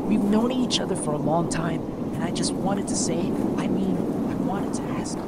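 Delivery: trailing from soft down to a whisper; fading shy confession. Acted. Herman confesses to Lefwin, fading into a whisper. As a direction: lose your nerve as the words fade. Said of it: "making his voice barely audible".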